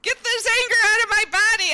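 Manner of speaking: high, loud, fast retold scream; woman reenacting her scream in the car; screaming out anger. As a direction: let the anger out in a yell. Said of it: "I just screamed out anger".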